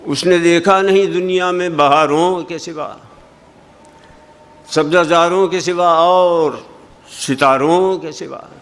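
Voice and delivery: mock hammering every word; mildly overdone stress on each word; mock overemphasis. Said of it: "हम समझते हैं कि अगर".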